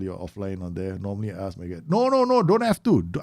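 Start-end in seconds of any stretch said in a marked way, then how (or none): 1.9-3.0 high, loud rush of no's; urgent refusal. Real, not acted. Streamer stopping a viewer paying for a subscription, urgent refusal. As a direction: cut them off, high and loud.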